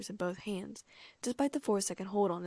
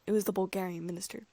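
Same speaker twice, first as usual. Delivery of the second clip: plain reading voice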